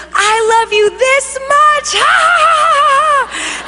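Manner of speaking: high, gleeful shout breaking into big laughter; acted laughing declaration. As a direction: proclaim it joyfully, then laugh it out high. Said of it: "he starts laughing".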